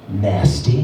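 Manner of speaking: very low put-on voice; old stripper. Old stripper customer giving her name, low voice. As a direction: drop right down for a worn-out stripper. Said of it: "And she goes".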